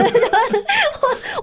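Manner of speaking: bright rising giggle; high giggle. Real, not acted. Guest giggles at herself, high giggle. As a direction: giggle at her own stumbling. Laughing at own fumbling.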